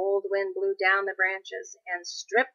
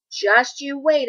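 fierce vow; 6 semitones higher, 6 dB louder, slower than usual. Acted. Angry tree vows revenge.

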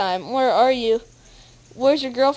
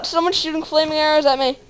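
normal talking voice

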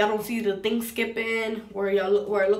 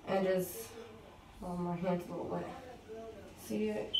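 usual speaking voice

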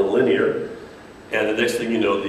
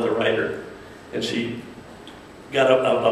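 ordinary delivery

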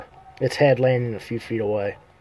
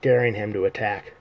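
ordinary delivery, nothing marked

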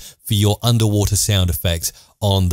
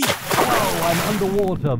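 underwater; 7 semitones higher, slower than usual. Shown as a demonstration. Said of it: "muffle my voice and make me sound like I'm underwater".

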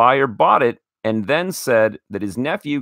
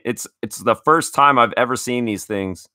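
ordinary talk

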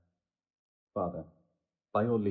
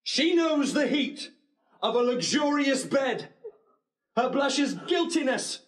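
furious accusation; 8 semitones higher than usual. Acted. Groom accusing his bride before the wedding guests, rising fury. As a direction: denounce her with bitter outrage.

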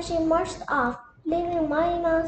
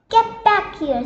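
louder, high call to stop; sharp order; 5 semitones higher, 6 dB louder than usual. Acted. Mildred stopping Minnie, sharp loud order. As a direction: snap her back into line.

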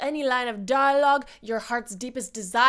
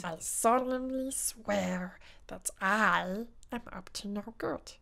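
sly soft voice; 6 semitones lower, 7 dB quieter, slower than usual. Acted. Impression request video.

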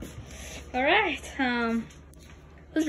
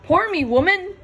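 mock bossy command; 7 semitones higher, 7 dB louder than usual. Ordering sister to pour ramen.

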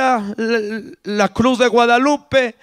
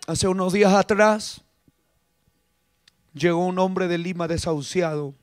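ordinary talk

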